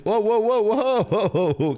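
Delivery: high-pitched "whoa, slow down", falling back at the end; startled alarm. Real, not acted. Streamer reacting to dialogue racing past, startled alarm.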